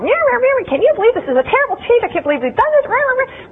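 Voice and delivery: high, fast meowing whine about the change; whiny cat. Acted. Coworker whining over lunch about a change, high meowing cat voice. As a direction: meow and complain like a catty coworker at lunch. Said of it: "They whine and manipulate to each other".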